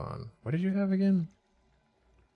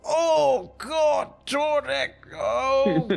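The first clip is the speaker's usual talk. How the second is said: pained loser; 13 semitones higher, much slower than usual. Acted. Beaten NPC groans in pain.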